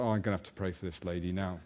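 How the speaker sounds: a muttered aside under the breath; saying it to himself. Retold or imitated. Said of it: "I said to myself".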